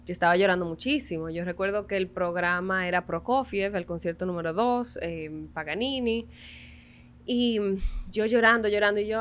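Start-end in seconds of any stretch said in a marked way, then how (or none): none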